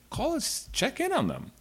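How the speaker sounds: a light mock of mom's innocent answer. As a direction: son imitating his mother claiming innocence. Son voicing mom's reply.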